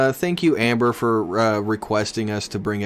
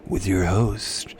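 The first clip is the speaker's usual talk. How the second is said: put-on creepy host voice; 5 semitones lower, 4 dB quieter than usual. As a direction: sign off in a creepy low put-on voice. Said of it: "You don't like this voice?".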